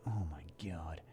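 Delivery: awed whisper. Real, not acted. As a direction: breathe it out in a whisper. Awed at huge account.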